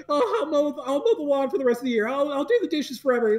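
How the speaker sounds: high, fast pleading promises; hammed-up childish begging; begging kid. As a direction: rattle off desperate bargains like a kid. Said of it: "he was just the whole".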